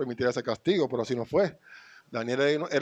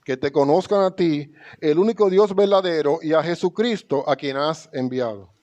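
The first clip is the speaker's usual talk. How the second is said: louder, lifted reading of the verse; scripture proclaimed; 3 semitones higher, 4 dB louder than usual. Acted. Lectura bíblica proclamada. (lectura bíblica proclamada)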